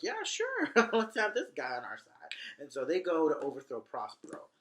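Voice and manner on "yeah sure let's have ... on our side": squeaky, much higher, laughing agreement; goofy sidekicks cackling yes; mocking drunk clowns. The drunk clowns agree to help Caliban, high and laughing. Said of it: "they're like".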